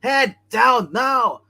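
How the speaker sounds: high, loud, slow yell of the order; shouted movie quote. Acted. Friend quoting a movie scene, a drawn-out shouted order. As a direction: yell the command across the room.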